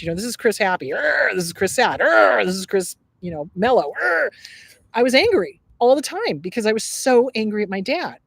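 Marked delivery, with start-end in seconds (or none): 0.9-1.3 mock angry growl. Host parodying own angry so much, angry growl. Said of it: "you're angry so much". 2.0-2.4 mock angry growl. Host parodying own angry so much, angry growl. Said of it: "you're angry so much". 4.0-4.3 mock angry growl. Host parodying own angry so much, angry growl. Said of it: "you're angry so much".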